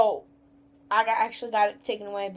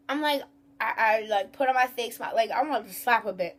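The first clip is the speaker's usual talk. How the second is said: ordinary talk, nothing marked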